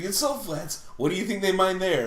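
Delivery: high, teasing know-it-all voice; mock incredulity. Teasing someone who said pepper flats, mock incredulous. Mocking quote about salt flats.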